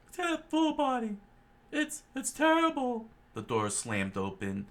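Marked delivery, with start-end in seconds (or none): 0.1-3.1 much higher, louder squeaky voice; high cartoon voice. Cook complaining, high and squeaky. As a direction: squeak it out in alarm. Character voice.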